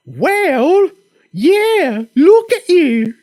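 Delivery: high, hammed-up Monty Python British housewife, slow on look at you; terrible Monty Python accent. Bricklayer's wife teasing her husband, fake British falsetto. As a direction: squeak it out like a Python lady. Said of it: "I'm doing a terrible Monty Python thing".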